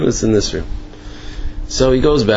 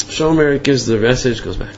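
ordinary talk, nothing marked

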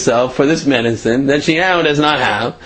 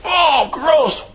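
very high squeaky disgust; mock disgust. Mugger tasting the medicine, high squeaky disgust. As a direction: spit out the taste in disgust.

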